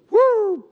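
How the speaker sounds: a high, loud police siren whoop; siren whoop imitation. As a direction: mimic a siren wailing behind you. (imitación aguda y fuerte de una sirena)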